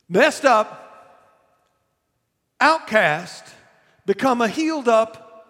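loud, rising sermon build; preaching. Acted. Preacher building the woman's hope to his congregation.

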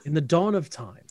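slow, grand storybook intro voice; epic fantasy narrator. Acted. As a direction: narrate like an ancient legend.

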